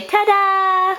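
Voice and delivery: high sing-song prompt. Shown as a demonstration. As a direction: lift it high for a toddler. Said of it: "Can you say".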